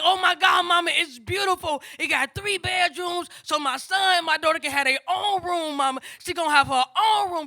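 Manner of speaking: high, loud, happy gushing; so excited. Retold or imitated. Daughter tells mama about her new apartment, so excited. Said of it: "She was so excited".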